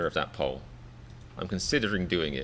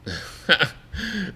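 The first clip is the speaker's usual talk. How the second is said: burst of laughter; 9 semitones higher than usual. Real, not acted. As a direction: crack up for a moment. Laughs at a viewer's comment.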